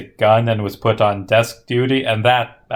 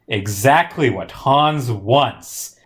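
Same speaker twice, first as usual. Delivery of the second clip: ordinary talk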